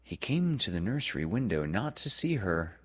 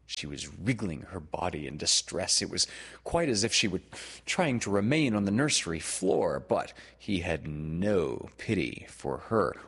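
plain reading voice

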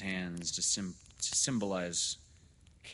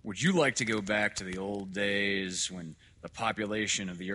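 severe doctor; 2 semitones higher, 8 dB louder than usual. Acted. Doctor lecturing father on population, severe and building. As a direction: lecture severely, piling up the figures. Dr. Hitz, a figure in the story.

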